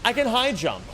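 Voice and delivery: surprised discovery. Real, not acted. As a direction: blurt it out in surprise. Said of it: "Wait a fucking second".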